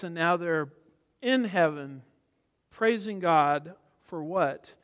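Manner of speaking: ordinary talk, nothing marked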